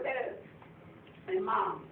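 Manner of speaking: ordinary delivery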